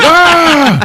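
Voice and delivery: high, loud hammed-up cry; loud mock pained yell; mock yelp of pain. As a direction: yelp as if hit below the belt. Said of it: "And he's like".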